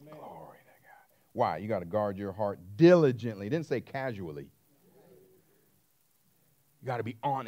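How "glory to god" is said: a whispered Glory to God; whispered praise. Real, not acted. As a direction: breathe it out as private praise.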